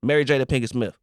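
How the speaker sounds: slow, drawn-out dramatic question; game-show question read. Acted. Podcast host posing a dilemma to friends, slow and dramatic. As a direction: tease the hosts with suspense in each pause. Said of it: "return to Ultimate Ultimatums".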